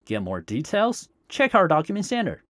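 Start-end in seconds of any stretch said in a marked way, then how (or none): none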